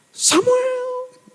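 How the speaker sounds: piercing falsetto imitation of a mother; shrill feminine call. Mother calling her lost son from afar, shrill imitated voice.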